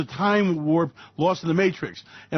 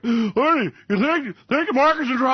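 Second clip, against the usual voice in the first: higher, worried little voice; wife asking; 7 semitones higher, 3 dB louder than usual. Acted. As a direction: play the anxious wife. Acted couple, wife asks.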